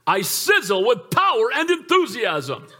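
hammed-up motivational chant; mock the hype man with a grin; laughing parody of a pep talk; mocking rah-rah speaker. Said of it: "This guy gets up there and he said".

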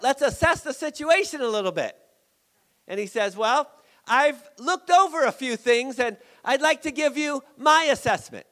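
high, loud, impassioned preaching; full-force preaching. Acted. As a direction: preach it out to the whole church.

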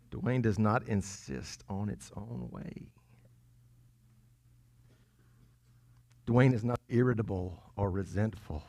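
hesitant, self-mocking recital; doubtful self-test. Shown as a demonstration. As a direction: read it as if unsure it's true. Testing verse with own name, doubtfully.

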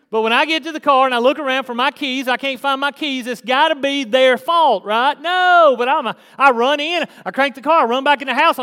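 loud, rising preacher's build; preaching at full force. Acted. Pastor preaching to his church, full force. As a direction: drive it home to the church. Retelling the keys story, preaching.

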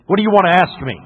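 put-on curt, impatient challenge to the boy; gruff monk. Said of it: "He gruffly looked".

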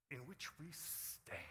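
slow whisper to end the verse; whispered close. Real, not acted. Preacher closing the Romans verse in a slow whisper. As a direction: breathe the last words, slow. Reading scripture ending softly.

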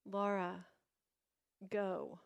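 hushed, firm summons, acted; God's last call. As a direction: say it quietly, with space between the words. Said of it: "God said that one last time".

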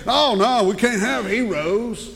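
hammed-up fake alarm; mocking objection. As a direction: scoff in someone else's words. Mocking attitude of film makers.

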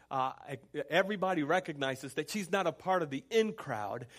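usual speaking voice

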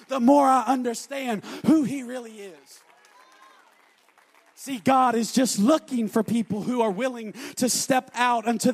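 loud, driving sermon climax; full-force preaching. Acted. Preacher shouts assurance of power to the congregation. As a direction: pound it home to the congregation.

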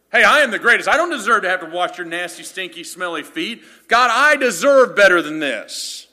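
loud, high, indignant bragging; arrogant disciple. Pretend. Disciple refusing to wash nasty feet, loud and arrogant. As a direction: sneer like you're too good to wash feet.

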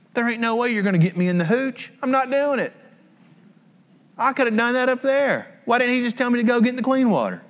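high-pitched mock outrage; indignant refusal. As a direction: complain like an offended VIP. Naaman's indignant refusal acted.

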